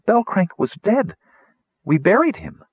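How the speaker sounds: high-pitched, pained disbelief; bewildered. A narrator's reading. Rainspot cannot believe the buried friend lives, bewildered. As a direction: protest in a high, puzzled voice.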